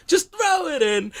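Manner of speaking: high, slow mock cooking-show voice; Julia Child accent. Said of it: "I'm like".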